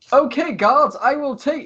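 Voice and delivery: high-pitched theatrical proclamation; showy rogue addressing an audience; Lucius, public announcement. Acted. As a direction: announce it high to the whole room. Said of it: "he looks around and goes".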